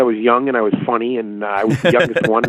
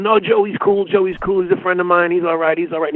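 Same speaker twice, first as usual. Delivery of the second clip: higher, soothing reassurance; calming friend; 7 semitones higher than usual. Retold or imitated. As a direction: talk someone down gently. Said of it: "Paul tells him".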